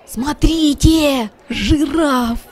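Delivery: calling the kittens over. A narrator's reading. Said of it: "звал котят Пряник". (зовёт котят, громче и выше)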